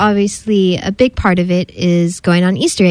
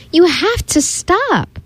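slow, high, emphatic telling-off; scolding; 6 semitones higher, much slower than usual. A narrator's reading. Mommy scolding the messy dog, slow emphatic reproach. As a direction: spell out the order slowly, pitched up.

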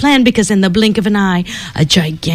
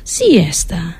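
sleepy whisper; 3 semitones lower than usual. A narrator's reading. Narrator sleepily whispers siesta.

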